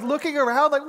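ordinary talk

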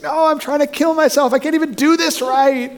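high, wailed protest, no real tears; imitating a distraught woman; imitated bawling. Stranded woman to her helper, mock bawling. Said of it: "She's bawling".

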